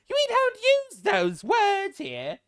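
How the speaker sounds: very slow, high, loud prissy parody; mocking the Speaker. Vlogger parroting the Commons Speaker, hammed-up mockery. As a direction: stretch each word like a pompous chairman.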